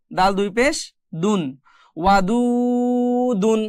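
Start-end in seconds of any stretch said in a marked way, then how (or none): 2.0-3.6 ওয়াদুদুন slowly recited with the middle দু held long; slow recited demo. Shown as a demonstration. Teacher reciting ওয়াদুদুন for students, slow chanted demo. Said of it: "এভাবে কিন্তু".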